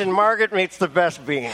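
ordinary talk, nothing marked

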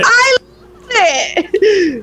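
very high, drawn-out delight; thrilled outburst. Real, not acted. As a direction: squeal it with laughing delight, stretching the words. Delighted at the new name.

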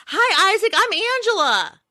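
put-on high, sing-song greeting to a child; Angela. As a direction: play a perky stranger meeting a little boy. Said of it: "And I was like".

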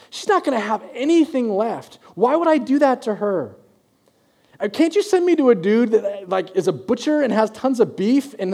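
high, disbelieving protest; incredulous complaint. Pretend. Elijah protesting God's instruction, high and incredulous. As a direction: whine against the order, pitch up.

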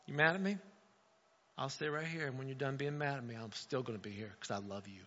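put-on gentle comfort to an angry child; tender God. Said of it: "God was like".

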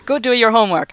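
high, loud scolding mother; nagging mom voice. Pretend. Acting nagging parent.

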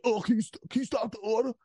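put-on high-pitched drunk heckling; put-on high, sloppy drunk voice; mock drunk ordering. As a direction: mimic a hammered customer fucking with staff. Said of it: "I was just so fucking drunk".

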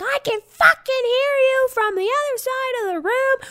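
very high shrill mocking voice; hammed-up angry friend; mock shriek. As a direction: imitate furious friend. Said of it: "she's like".

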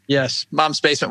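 ordinary talk, nothing marked

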